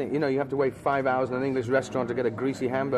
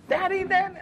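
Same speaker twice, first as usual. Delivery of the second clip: much higher, imitating a frightened child calling out; scared toddler. Dad imitating his daughter's panicked call for him, much higher. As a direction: squeal it like a tiny girl in a panic.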